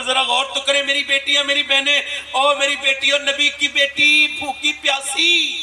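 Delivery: ordinary talk, nothing marked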